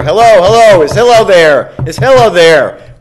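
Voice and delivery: loud, high calls of hello; calling out loudly. Acted. Pesterer at the door calls for Hillel, loud and insistent. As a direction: holler up the stairs to fetch him.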